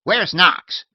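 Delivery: put-on old lady shooing her maid off, tart and loud; shrill old lady. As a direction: bark it like a cranky old lady. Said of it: "she was saying tartly".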